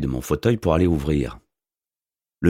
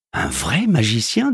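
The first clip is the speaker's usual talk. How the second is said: slow, higher, amused rise on magicien; a postman who thinks it's a gag; mocking disbelief; 6 semitones higher, slower than usual. A narrator's reading. Postman asking the wizard if he's real, mocking.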